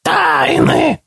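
very high creaking creature voice; creaky creature. A narrator's reading. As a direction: screech it like a hungry beast. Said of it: "проскрипело одно из существ".

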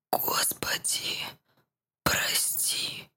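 breathless, shaky whispered plea; trembling whisper. Acted. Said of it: "прошептал дрожащим голосом Тимотео".

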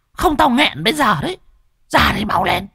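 very high, fast eerie spirit voice; ghost giving orders. Acted. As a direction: demand it like a greedy ghost. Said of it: "nó còn vừa ra lệnh".

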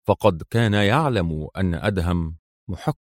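usual speaking voice

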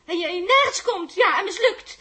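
loud contemptuous shouting; furious put-down; angry shouting. Acted. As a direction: hammer it home. Quarrel continues.

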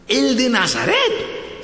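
stretched, high-pitched disbelief; slow, high, incredulous double-check; astonished question. Acted. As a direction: draw it out in hammed-up amazement. Blind man realises, asks again.

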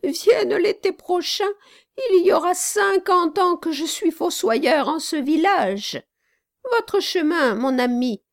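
put-on a kindly old man pointing the way; old gravedigger.

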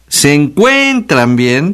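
Se encuentran bien, slow, each stressed E pushed high; exaggerated stress on E. Shown as a demonstration. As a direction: stretch and lift the e in encuentran and bien. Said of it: "con acento en la E". (Se encuentran bien, lento, exagerando las e acentuadas)

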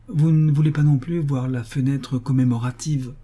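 ordinary delivery, nothing marked

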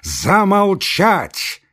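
high, very slow booming order; commanding cry. Acted. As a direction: command silence pompously. Samoilenko's grand order.

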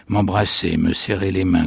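ordinary delivery, nothing marked

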